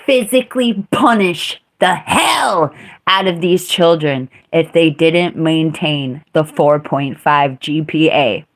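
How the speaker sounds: usual speaking voice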